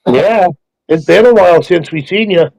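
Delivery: ordinary talk, nothing marked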